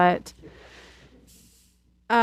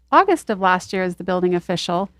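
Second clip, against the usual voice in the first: ordinary delivery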